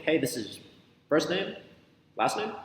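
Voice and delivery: "first name" and "last name" each lifted high, with pauses; pause, then pitch "last name" up like a question; questioning intonation. Shown as a demonstration. Coach teaching name as a question, questioning intonation. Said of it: "saying your name as a question".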